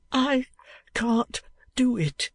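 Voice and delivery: high, hushed, dejected whisper; a broken boy giving up, breathless; faint high whisper of defeat. A narrator's reading. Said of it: "said the dejected creature".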